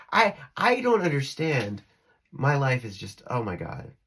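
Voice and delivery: normal talking voice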